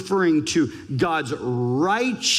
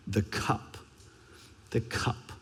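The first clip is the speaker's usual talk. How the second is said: soft, low and much quieter; hushed; 8 semitones lower, 11 dB quieter than usual. Acted. Preacher repeats the cup in a hushed, grave voice. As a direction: murmur it, grave and heavy. Dramatic repeated word in sermon.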